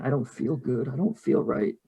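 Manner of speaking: soft, weak tearful murmur; hushed, mildly tearful complaint; crying, understated. Acted. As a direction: whimper it quietly to yourself. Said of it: "crying, and just saying".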